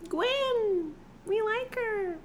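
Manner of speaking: high, squeaky little shadow voices; high-pitched cooing shadow sprites; little shadow creatures. Acted. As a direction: coo it like tiny shadow creatures smitten with her. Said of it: "his little shadow friends were like".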